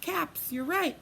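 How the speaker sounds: ordinary delivery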